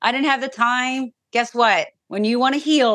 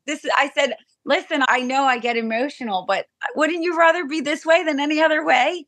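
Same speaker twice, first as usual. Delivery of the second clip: tearful; 6 semitones higher than usual. Real, not acted. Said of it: "all the crying and happy tears".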